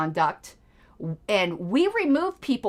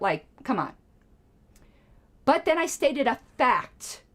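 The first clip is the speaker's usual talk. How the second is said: ordinary talk, nothing marked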